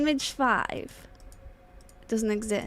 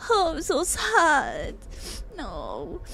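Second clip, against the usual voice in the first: normal talking voice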